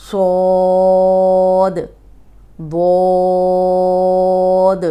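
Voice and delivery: held very long and loud, lips unrounded; long heavy dwad. Shown as a demonstration. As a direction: stretch it straight and full. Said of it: "تو سیدھا اوپر لے کے جائیں". (سیدھا، موٹا اور لمبا تلفظ)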